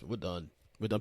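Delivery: ordinary delivery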